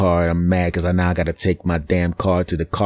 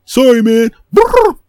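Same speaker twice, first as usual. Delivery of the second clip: pitched way up, chirpy bird with brr trills; high squeaky bird voice; 6 dB louder than usual. Acted. Bird talking on its phone, squeaky and high.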